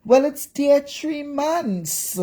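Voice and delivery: slow, high, mocking imitation of his excuse; drawn-out sneering mimicry; mocking Chris. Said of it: "coming on a live today to further".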